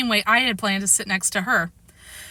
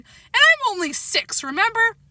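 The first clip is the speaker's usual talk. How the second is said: whining; 12 semitones higher than usual. A narrator's reading. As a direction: plead as a small child. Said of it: "Daniel whined".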